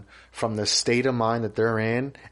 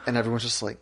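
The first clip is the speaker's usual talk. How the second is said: ordinary talk, nothing marked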